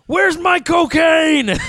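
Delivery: raging shout, high and drawn out; hammed-up angry outburst from a TV painter; Bob Ross. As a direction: yell it slowly like a furious star. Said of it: "Bob Ross had anger issues".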